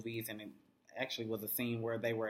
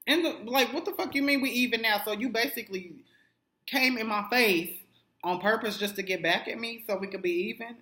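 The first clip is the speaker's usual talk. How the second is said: outraged retort; 9 semitones higher, 5 dB louder than usual. Retold or imitated. Acted quarrel, Issa confronting Daniel.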